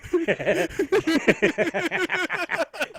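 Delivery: high burst of laughter; real laugh, loud; big laugh. Real, not acted. Friend cracking up at a joke, high laughing burst.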